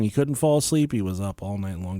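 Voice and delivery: ordinary delivery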